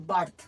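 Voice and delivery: বার্থ with an open "aa" for the i; vowel as আ. Shown as a demonstration. Teacher applies the first rule to birth, pronunciation demo. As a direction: say বার্থ as "baarth". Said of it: "আ হবে, মানে হচ্ছে".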